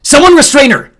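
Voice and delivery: loud, high barked order; shouting. A narrator's reading. Xander shouting Jordan back out of the cockpit, loud. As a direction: command him back, full voice. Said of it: "Xander shouted from the front".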